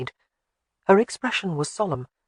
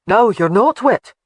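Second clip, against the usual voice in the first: louder, slow, cheerful declaration; announced; 5 semitones higher, 8 dB louder, slower than usual. Acted. Father comforting his frightened daughter, cheerful slow announcement. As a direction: proclaim it to cheer up the child. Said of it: "he announced".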